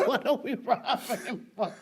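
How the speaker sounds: words lost in real laughter; laughing repeat. Real, not acted. Comic repeating her punchline to host, laughing hard. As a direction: repeat the punchline while cracking up.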